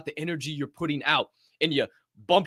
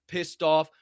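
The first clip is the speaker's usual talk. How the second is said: usual speaking voice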